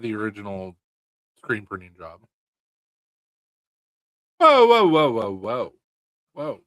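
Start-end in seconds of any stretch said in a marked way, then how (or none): 4.4-6.6 high, loud startled whoas; surprised. Real, not acted. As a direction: caught off guard, crying whoa. Startled by a comment.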